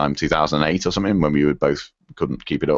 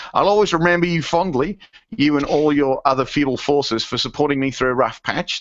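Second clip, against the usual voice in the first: higher-pitched attempt at an Australian accent; Aussie accent; 8 semitones higher than usual. Podcast host reading a listener's farewell email, in an Aussie accent. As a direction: put on a light Aussie voice. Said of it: "read this in an Aussie accent".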